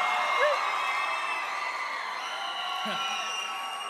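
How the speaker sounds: big open burst of laughter; loud laugh. Real, not acted. Pastor laughing at his own pregnancy announcement. Crowd reacts, speaker laughs.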